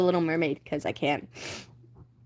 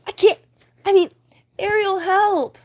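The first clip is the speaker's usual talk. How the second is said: high, loud pleading; stuck and panicking; 11 semitones higher, 6 dB louder than usual. Acted. Flounder stuck in porthole, high panicked plea. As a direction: beg for help, panicked.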